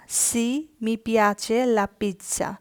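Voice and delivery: very slow, every word of Sì, mi piace laid out clearly; slow teaching read. Shown as a demonstration. Teacher reading the yes answer very slowly for learners. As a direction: drawl Sì, mi piace out for the class.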